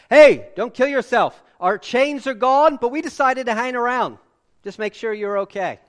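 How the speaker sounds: loud, casual shout across the prison; Paul calling out loud. Retold or imitated. Paul shouting to the panicking jailer, loud and casual. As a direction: yell it like a relaxed buddy reassuring the guard. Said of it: "Paul called out in a loud voice".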